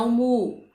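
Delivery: mu singled out and held firmly; isolated stressed syllable. Shown as a demonstration. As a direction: isolate the strong syllable mu for the class. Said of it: "a sílaba tônica é a antepenúltima". (mu isolado, dito com ênfase)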